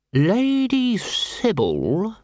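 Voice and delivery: high, slow, precise lawyer reading out terms; a fussy lawyer spelling out the deed; prim lawyer voice. A narrator's reading. Lawyer to Vimes, fussy and slow.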